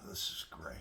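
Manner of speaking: whispering. Real, not acted. Unvoiced after lighting pipe.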